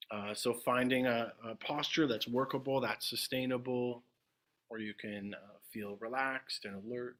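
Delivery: unhurried meditation guidance with long silences; guided meditation. Acted. Teacher guiding the group into meditation, spacious and measured. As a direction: settle listeners gently, phrase by phrase, leaving long pauses.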